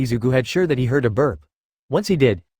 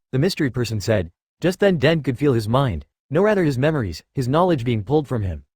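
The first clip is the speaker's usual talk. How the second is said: ordinary delivery, nothing marked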